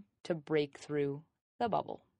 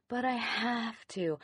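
higher, drawn-out protest; mock whining; 5 semitones higher, slower than usual. Person who can't let go of work, whining. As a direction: imitate a slow, pleading objection. Voices person resisting change.

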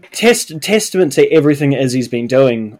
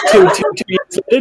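laughing exclaim; 7 semitones higher, much faster than usual. Real, not acted. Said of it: "stunned correction".

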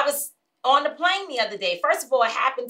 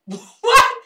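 high, loud mock "what" of disbelief; exaggerated incredulous yelp; retold stunned outburst; 12 semitones higher, 10 dB louder than usual. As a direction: blurt it out in imitated shock, pitched way up. Acting out man's stunned reply.

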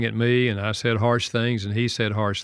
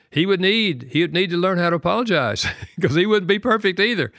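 much higher, breaking into a laugh; amused, laughing; 8 semitones higher, 4 dB louder than usual. Real, not acted. Father on his son needing to apologize too, amused and laughing. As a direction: let the voice rise into a chuckle.

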